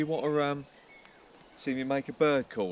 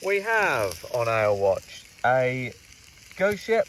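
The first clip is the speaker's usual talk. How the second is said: announcer voice; much slower than usual. Acted. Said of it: "Right, I'm gonna do an ale watch".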